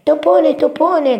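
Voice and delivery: very high, loud call; grido di Topino. A narrator's reading. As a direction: cry out like an exasperated little mouse. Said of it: "gridò Topino". (grido acuto di topolino)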